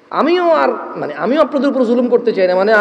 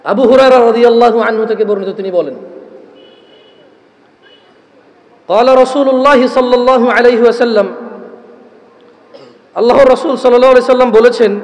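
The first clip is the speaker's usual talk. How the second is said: loud, measured sermon recitation with chanted Arabic; solemn preacher reciting scripture to the crowd; preaching a hadith; 3 dB louder, slower than usual. Acted. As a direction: intone the Arabic slowly, then preach the meaning.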